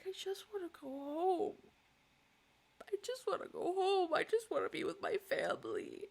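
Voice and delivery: high sobbing plea; crying. Real, not acted. Homesick vlogger crying for her family, sobbing. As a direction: sob out the wish to go home. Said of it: "This is a very interesting cry".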